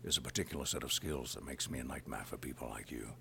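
low, quiet menacing threat; a hushed, deadly calm warning; gravely quiet Taken-style threat; Liam Neeson impression.

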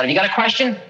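high, sudden call across the yard; yelled question. Retold or imitated. Guru yelling a question at the approaching visitor. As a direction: call out to him from yards away. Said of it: "he yells out".

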